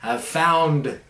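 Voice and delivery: monk flatteringly praising the other, theatrical; Jim Carrey style gushing. Pretend. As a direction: ham up a grand cosmic compliment. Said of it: "I'm throwing some Jim Carrey lines at you".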